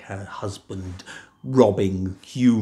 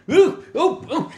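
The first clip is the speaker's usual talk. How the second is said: high wobbling cries; 4 dB louder than usual. Acted. Jack shaken in pot.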